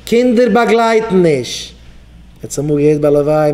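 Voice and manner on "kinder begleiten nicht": called out. Retold or imitated. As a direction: proclaim it slowly to the crowd. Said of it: "rief aus". (אויסגערופן, פאמעלעך און הויך)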